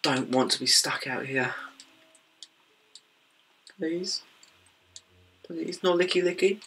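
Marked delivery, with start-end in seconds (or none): 3.8-6.6 higher, whiny plea in someone else's catchphrase; borrowed catchphrase. Retold or imitated. Gamer borrowing another youtuber's catchphrase, higher whiny plea. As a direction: mimic a pleading catchphrase, pitched up. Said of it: "I've just stolen someone's catchphrase".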